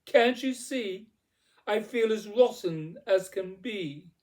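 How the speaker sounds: high, loud, exasperated complaint; crying out. A narrator's reading. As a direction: wail it indignantly at the fairy. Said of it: "cried Cindy".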